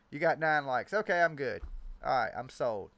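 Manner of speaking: usual speaking voice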